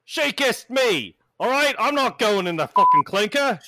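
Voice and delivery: loud, high British protest; indignant British bartender. Acted. Bartender to onlookers after being kissed, indignant British shout. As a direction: protest at full volume in a British accent. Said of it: "He's like".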